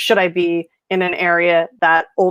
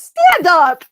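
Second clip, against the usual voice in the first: much higher and louder mock command; bossy old lady; 10 semitones higher, 7 dB louder than usual. Nana ordering her to stand up to see more tattoos, high and loud. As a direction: bark it like an eager granny. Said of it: "she goes".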